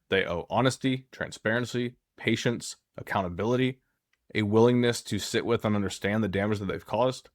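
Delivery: ordinary talk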